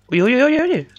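alarmed calling out. Real, not acted. Alarm at a threat in game.

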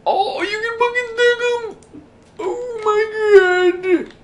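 very high-pitched excited squealing; thrilled squeal. Real, not acted. Gamer finding that blocks stack, thrilled high squeal.

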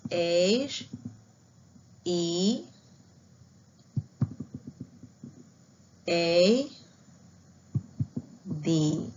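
letters drawn out one at a time; spelling along. Shown as a demonstration. Teacher spelling head for pupils to follow. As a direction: lead the class through each letter. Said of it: "A ver, todos conmigo".

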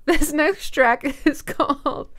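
giggling through the words; laughing. Real, not acted. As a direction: laugh while announcing it. Laughing at the odd title.

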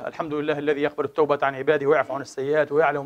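measured, solemn khutbah voice; sermon opening. Acted. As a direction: open the sermon with quiet reverence. Opens the closing sermon. (افتتاح خطبة بصوت خاشع)

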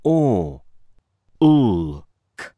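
"Or. L. K." as separate sounds, "L" silent part; sounding out words. Shown as a demonstration. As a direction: segment the sounds one at a time. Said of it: "It makes the sound or".

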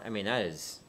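ordinary talk, nothing marked

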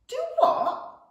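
very high, loud squawk. A narrator's reading. Frightened goblin squawks at his brother. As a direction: squawk it in panic. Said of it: "squawked Saggy Pant".

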